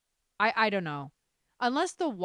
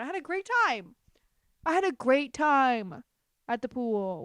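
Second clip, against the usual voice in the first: sarcastic cheer; 6 semitones higher, 5 dB louder than usual. Retold or imitated. Sarcastic recap of bad day.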